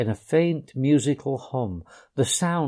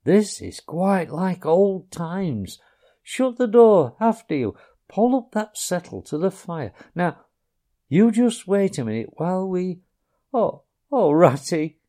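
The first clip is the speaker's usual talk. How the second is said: higher Mole voice turning to dismay; bustle, then break off in despair; Mole voiced; 7 semitones higher than usual. A narrator's reading. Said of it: "he cried in despair".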